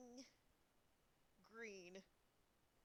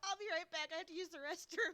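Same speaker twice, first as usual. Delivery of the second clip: said through laughter, higher and louder; laughing; 8 semitones higher, 7 dB louder than usual. Real, not acted. Gamer telling viewers she'll be right back, laughing. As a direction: still giggling as you speak.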